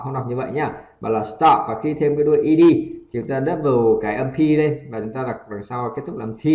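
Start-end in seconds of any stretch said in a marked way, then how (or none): 1.2-1.6 "stop" in one beat, T without puff; unaspirated STOP. Shown as a demonstration. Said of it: "không bật hơi nữa". ("stop" một âm, T không bật hơi)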